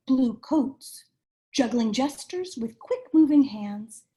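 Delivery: lively picture-book read-aloud, rhyming bounce; reading to children. A narrator's reading. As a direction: read it like bedtime story time for little kids.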